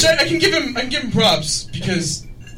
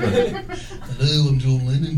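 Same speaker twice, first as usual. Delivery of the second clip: lower, Liverpool-ish laughing voice; 7 semitones lower, much slower than usual. Shown as a demonstration. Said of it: "Now do your [name]".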